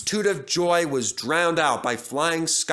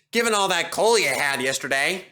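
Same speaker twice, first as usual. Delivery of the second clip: light teasing engine voice; a hint of a smug young engine; teasing James; 4 semitones higher, 3 dB louder than usual. A narrator's reading. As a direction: needle him playfully. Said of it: "laughed James".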